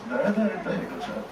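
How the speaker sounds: soft, clipped butler murmur; dry butler. Acted.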